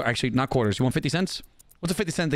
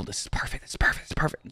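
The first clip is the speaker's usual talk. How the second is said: gleeful whisper; 4 semitones lower, 5 dB quieter, much faster than usual. Real, not acted. Gloating quietly over a customer.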